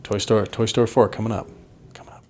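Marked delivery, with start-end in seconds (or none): 1.9-2.2 much softer fading echo of the phrase. Pretend. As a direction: drop to a faint echo. Echoes own words very softly.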